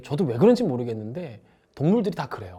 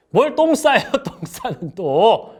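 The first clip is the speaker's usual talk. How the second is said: mocking reply; 7 semitones higher, 6 dB louder, much slower than usual. Mocking a boyfriend's answer.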